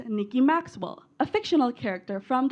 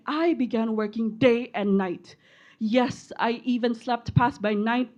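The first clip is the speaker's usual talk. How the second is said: ordinary talk, nothing marked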